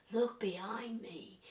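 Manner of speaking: hushed, slow imitation of a background voice; very low voice. Imitating the male spirit voice on the recording, very low. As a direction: whisper it slowly, barely there. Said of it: "It was very low".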